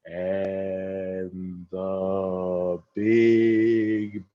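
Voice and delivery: extremely slow, low, dragged out; slow motion. Shown as a demonstration. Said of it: "Even slower".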